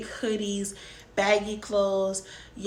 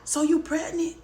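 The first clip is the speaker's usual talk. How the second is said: mom pressing; 8 semitones higher than usual. Retold or imitated. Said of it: "She said".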